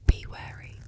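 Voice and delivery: usual speaking voice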